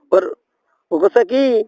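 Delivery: ordinary delivery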